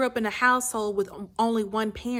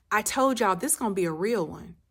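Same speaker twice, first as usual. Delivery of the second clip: ordinary talk, nothing marked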